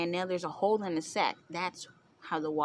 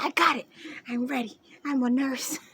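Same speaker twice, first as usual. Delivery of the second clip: raised, insistent imitation; mock take-charge sister; 5 semitones higher than usual. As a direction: blurt it as an overeager helper. Imitates frantic nurse sister taking charge.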